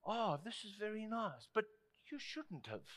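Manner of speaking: soft, underwhelmed thanks; restrained adult politeness. Retold or imitated. Preacher mimics adult unwrapping a gift, politely restrained. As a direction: play a polite adult receiving a gift, holding back.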